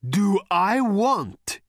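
big drawn-out voice; booming thunder god. A narrator's reading. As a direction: throw the question back, incredulous. Thor thunders back at the challenge.

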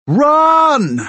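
high, full-force bellow. A narrator's reading. Fighter warns a friend to flee the possessed man, bellowing. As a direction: bellow a desperate command. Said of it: "I bellow at Afia".

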